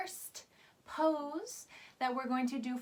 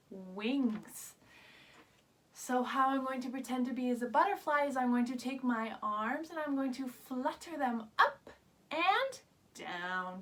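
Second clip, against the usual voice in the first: ordinary delivery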